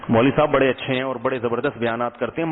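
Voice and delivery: ordinary delivery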